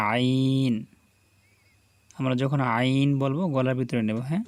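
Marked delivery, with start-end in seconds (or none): 0.1-0.7 throat-pressed আইন; throaty ain. Shown as a demonstration. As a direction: sound আইন from mid-throat. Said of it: "কণ্ঠনালীর মাঝখান থেকে উচ্চারিত হয় আইন".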